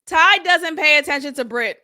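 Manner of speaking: ordinary delivery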